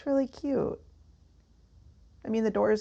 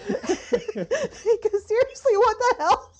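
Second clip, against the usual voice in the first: high-pitched, slowed by real laughter; exasperated through helpless laughter; laughing; 11 semitones higher, 3 dB louder, slower than usual. Real, not acted. Helpless laughter during outtake.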